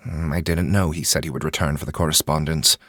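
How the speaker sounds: ordinary delivery, nothing marked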